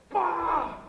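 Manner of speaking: a loud, high mock "Bah!" of a kid charging; mock wild lunge yell. Coach mocking a reckless lineman, loud mock grunt. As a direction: bark out a cartoonish lunge cry, mocking it. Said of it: "kids don't have to worry about".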